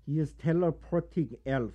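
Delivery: mock German accent, broken English; hammy German villain accent. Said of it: "my German voice".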